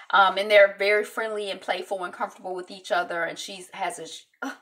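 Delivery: normal talking voice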